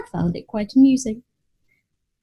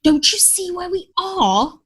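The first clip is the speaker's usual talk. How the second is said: exclaim; 8 semitones higher than usual. A narrator's reading. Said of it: "I exclaimed".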